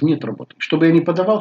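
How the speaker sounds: ordinary talk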